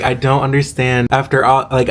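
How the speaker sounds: ordinary talk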